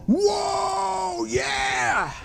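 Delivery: high, whooping crowd cheer. Retold or imitated. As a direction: whoop it up like the stands. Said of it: "everybody's going".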